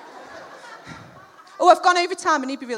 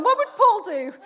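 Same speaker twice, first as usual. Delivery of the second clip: loud, raised mock slogan; comic motto; 6 semitones higher, 7 dB louder than usual. Mum joking about her own wristband, loudly proclaimed.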